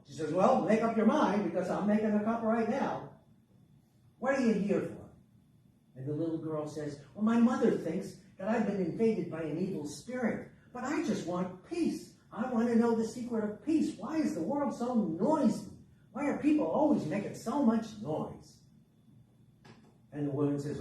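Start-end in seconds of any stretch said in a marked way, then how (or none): 0.3-5.1 brusque, louder and higher; impatient old woman. A narrator's reading. Old woman making tea questions her young visitor, impatient. 7.1-18.5 put-on high, exasperated child's complaint; plaintive little girl. A narrator's reading. Little girl explains her trouble to the old woman, plaintive.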